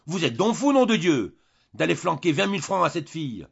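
crier, beaucoup plus fort et plus haut; cri furieux. A narrator's reading. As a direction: hurler d'indignation vers les beaux-parents. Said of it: "il cria vers ses beaux-parents". (Julien crie, indigné, contre les beaux-parents)

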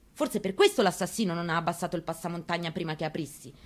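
usual speaking voice

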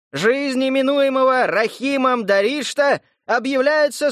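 booming herald's proclamation; town crier. Acted. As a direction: proclaim loudly to the crowd. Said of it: "глашатай громогласно объявил".

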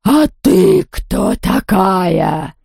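very slow, drawn-out menacing question; threatening ghost. A narrator's reading. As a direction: stretch each word as a threat. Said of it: "угрожающе прошипела она".

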